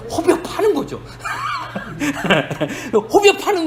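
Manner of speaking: real laughter over the point; laughing. Real, not acted. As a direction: chuckle while saying it. Laughing at the cruel remark.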